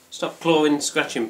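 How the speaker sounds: loud sharp telling-off; scolding the cat. Real, not acted. Man scolding his cat for clawing while recording, loud. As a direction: snap at the cat to stop.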